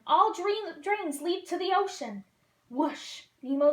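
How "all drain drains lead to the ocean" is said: yelling. A narrator's reading. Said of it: "Gil yelled to him".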